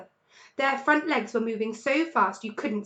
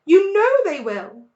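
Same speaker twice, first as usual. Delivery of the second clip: higher, louder tearful plea; mother on the edge of tears; sobbing; 9 semitones higher, 12 dB louder than usual. A narrator's reading. Mrs Fox pleading with her husband, sobbing. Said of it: "sobbed Missus Fox".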